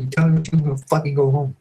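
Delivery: high, heated threat to leave; angry blow-up. Retold or imitated. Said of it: "And it blew up".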